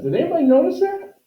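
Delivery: high, fast sudden question; excited. Real, not acted. Teacher asking students if they caught the mistake, high and excited. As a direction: call out to the class in surprise. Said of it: "teacher catches own mistake".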